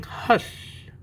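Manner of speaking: breathy little hush; whispered hush. A narrator's reading. Quiet old lady hushing the room, whispered. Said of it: "quiet old lady who is whispering".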